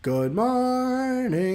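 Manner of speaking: high, long drawn-out good morning; cheery greeting. Acted. Greeting a coworker before the shift, cheery. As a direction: sing it out high and very slowly.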